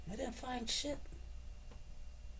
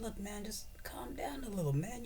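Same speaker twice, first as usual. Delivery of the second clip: softer whispered plea to calm down; Ukraine's president whispering to Biden to calm down; hushed calming; 6 semitones higher, 7 dB quieter than usual. Retold or imitated. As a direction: hush him like a nervous friend. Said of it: "the Ukrainian president tells Biden".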